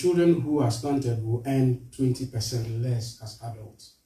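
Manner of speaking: ordinary talk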